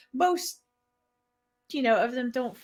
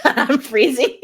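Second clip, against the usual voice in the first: laughing; 4 dB louder than usual. Real, not acted. Laughing while admitting she is cold.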